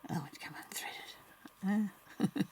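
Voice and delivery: slow breathy whisper to herself; dismayed slow whisper. Real, not acted. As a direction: murmur it slowly under her breath. Muttering at the needle while sewing.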